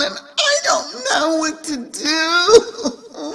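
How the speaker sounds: high, tearful wail; high, slow blubbering; crying. A narrator's reading. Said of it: "kept on crying".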